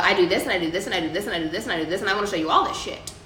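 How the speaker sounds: rattled off very fast, a mock show-off listing everything; showing off, rattling on. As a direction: gabble it like an eager show-off. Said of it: "we're just like".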